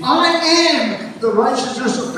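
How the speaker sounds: "I am" held high and strong; emphatic declaration. Shown as a demonstration. Preacher leading congregation to declare righteousness, emphatic declaration. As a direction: lean hard on "I am". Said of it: "put some emphasis on it".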